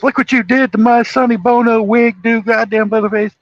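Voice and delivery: high, loud mock yell of a crazed brother; hammed-up high cackling villain voice; yelling movie character. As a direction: shriek it like a deranged movie killer. Retelling Chop Top yelling.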